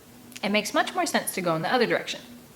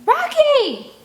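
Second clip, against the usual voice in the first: much higher, loud call; shout; 9 dB louder than usual. Acted. Narrator shouting after fleeing friend, loud. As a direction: call after him across the woods. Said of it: "I yell again".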